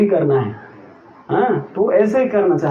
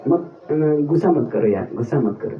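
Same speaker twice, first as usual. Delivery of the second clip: lower, softer, teasing calm-down; mock soothing tease; 7 semitones lower, 4 dB quieter, faster than usual. Teasing an angry devotee friend, mock soothing. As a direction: coo it low to rile him up. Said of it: "क्या बोलने से इसको गुस्सा आएगा".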